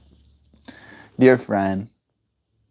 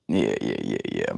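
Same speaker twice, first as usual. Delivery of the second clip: deep joke voice; 9 dB quieter than usual. Pretend. Playful agreeing aside.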